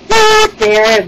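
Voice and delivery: very high, loud little voice; soothing dairy maid. A narrator's reading. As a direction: pipe up high to console.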